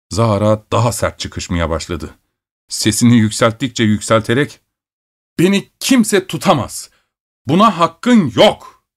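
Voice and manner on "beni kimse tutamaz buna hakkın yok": tiz, yükselen bir çıkışma; uşağa öfkeyle, tiz sesle çıkış; bağırış. A narrator's reading. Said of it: "diye bağırıyordu". (müzisyen uşağa kafa tutarak, tiz bağırış)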